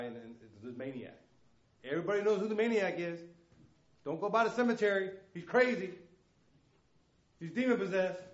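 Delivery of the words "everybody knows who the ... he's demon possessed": loud, high preaching cry; fiery preacher at full volume; preaching at full force. Acted. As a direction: lift the room, shout it out. Preaching about the maniac.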